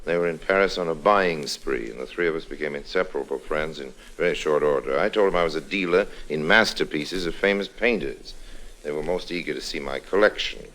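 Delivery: soft, confiding narrator voice; story narration. A narrator's reading. Harry telling listeners how he met the women, hushed narration.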